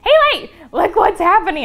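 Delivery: high, loud call across the room; excited yell. Retold or imitated. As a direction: shout for a friend to come see. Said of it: "I yell over to my friend Haley".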